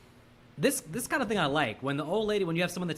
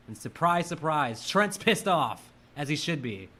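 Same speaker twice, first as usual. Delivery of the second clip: normal talking voice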